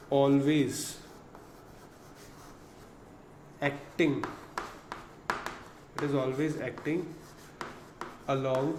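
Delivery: very slow, long pauses between words; halting dictation. Shown as a demonstration. Teacher pausing so students can copy the law. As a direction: spell it out piece by piece. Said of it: "dictating with long pauses".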